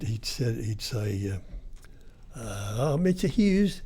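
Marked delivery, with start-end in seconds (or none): 2.8-3.8 high, put-on silly voice. Prank caller teasing Kim Hughes on the phone, high silly voice. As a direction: ham it up as a cheeky prank caller. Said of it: "he was putting on a silly voice".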